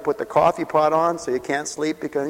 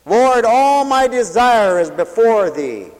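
higher, louder and slow, a pleading cry; mother's prayer; 9 semitones higher, 10 dB louder, slower than usual. Retold or imitated. Weeping mother crying out her prayer to the Lord, loud. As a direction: cry it up to the Lord like she did.

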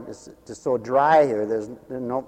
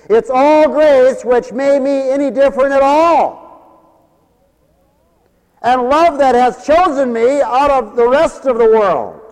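high, loud preaching climax; fiery preaching; 13 semitones higher, 13 dB louder than usual. Acted. Preacher proclaiming grace to radio listeners, full force.

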